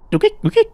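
very high squeaky poking sound; tiny high-pitched comic blips; comical noise. Acted. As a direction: squeak like a poked cartoon cable. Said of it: "that comical noise that you was like".

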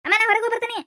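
put-on child's high call to a parent; calling to mother. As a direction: announce to mum that you are off. Child calls to mother while leaving.

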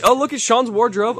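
ordinary talk, nothing marked

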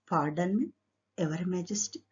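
normal talking voice

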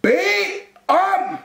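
high, loud yell, words apart; shouting. A narrator's reading. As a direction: shout each word separately in exasperation. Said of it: "råbte han". (råber hvert ord)

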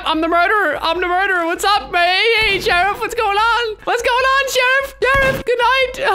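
very high-pitched silly taunting; squeaky troll voice. Pretend. Trolls players in squeaky put-on voice.

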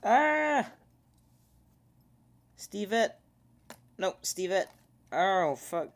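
high startled shouting; alarmed yells. Real, not acted. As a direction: yell out in panic. Game character dies.

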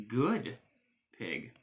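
usual speaking voice

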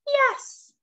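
high-pitched called-out yes; witch's cry. A narrator's reading. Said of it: "cried the witch".

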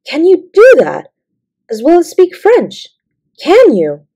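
astonished questions, swooping high; amazed gasp. A narrator's reading. Girl quizzes her friend about making up stories, astonished. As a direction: pitch each question up in disbelief. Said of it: "she gasped".

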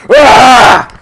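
loud high raging scream; frustrated scream. Real, not acted. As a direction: let out a raw yell of rage.

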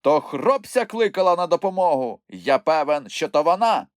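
agitated insistence. A narrator's reading. Moomintroll realises who called for help, agitated.

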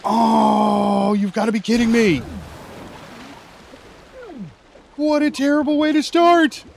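loud, high exasperated shout; exasperated yell. Real, not acted. Gamer yelling at the game, loud exasperation. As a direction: throw up your hands and yell it.

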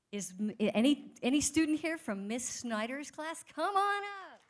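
loud, lifted call to the audience; calling out to a crowd. Real, not acted. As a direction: call them up to the stage, loudly. Host calling winner's student from crowd.

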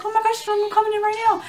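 high, loud acted-out panic; freaking out. Retelling the scan to viewers, mock freaking out. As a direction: play up a frantic high-pitched panic. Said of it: "I'm in there freaking out".

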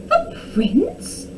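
breathless, high whispered surprise; shocked whisper. A narrator's reading. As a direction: gasp it out in disbelief. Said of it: "cried Tom".

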